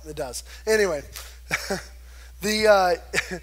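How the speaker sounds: usual speaking voice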